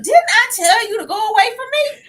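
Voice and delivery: high, loud, indignant scolding; mock scolding wife. Co-host imitating wife sending husband away, mock scolding. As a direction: shriek it as a hammed-up telling-off. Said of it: "She's like".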